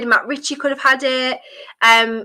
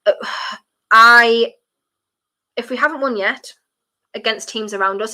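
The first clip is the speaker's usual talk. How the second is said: ordinary talk, nothing marked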